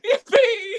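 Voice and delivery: high, loud whoop of joy; joyful yell. Acted. Overjoyed man running through town, whooping with joy. George runs home overjoyed.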